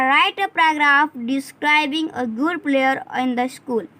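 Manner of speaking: slow reading of the task; slow classroom reading. Shown as a demonstration. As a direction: read the task slowly for the class. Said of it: "activity है हमारा".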